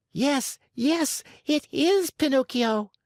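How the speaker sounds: high excited scream of recognition. Acted. Pulcinella recognises Pinocchio, screaming with delight. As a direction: cry it out with joy. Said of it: "screamed Pulcinella".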